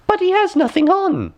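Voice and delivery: put-on very high, loud child's cry. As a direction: blurt it like a small child. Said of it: "a little child cried out".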